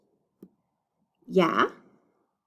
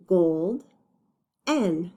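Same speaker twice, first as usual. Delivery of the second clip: GOLD, N as two separate beats with a long gap; GOLD, pause, then N on its own; broken into syllables. Shown as a demonstration. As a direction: sound out golden part by part. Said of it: "read them when they're broken up".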